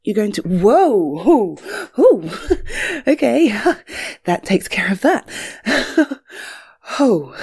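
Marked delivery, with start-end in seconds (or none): 0.0-2.3 loud, breathless surprise as she's swept up; startled squeal. Acted. Woman lifted by her lover, loud startled yelp. 2.3-3.0 airy, unvoiced giggle; breathy giggle. Woman giggling in her lover's arms, airy giggle. Giggle after being lifted.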